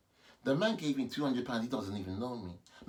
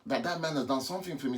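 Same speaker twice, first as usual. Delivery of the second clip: ordinary talk, nothing marked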